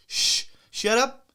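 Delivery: acted call for silence; hushing a crowd. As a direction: shush them slowly and firmly.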